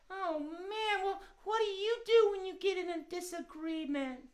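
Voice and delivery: high, small cat puppet voice; puppet cat asking advice. Pretend. Puppet cat asks host.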